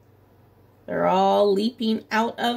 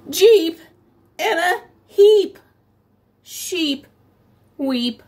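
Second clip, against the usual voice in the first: higher and louder crash announcement; dramatic crash line; 7 semitones higher, 6 dB louder, slower than usual. A narrator's reading. Teacher dramatizing the crash to kids, loud and high. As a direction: bang out the crash.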